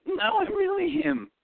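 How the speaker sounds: high, slow, whimpery squeak; mock your shy teenage self in a tiny high voice; timid young DJ. Teen DJ insisting to a doubting father, high and whimpering. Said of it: "And I go".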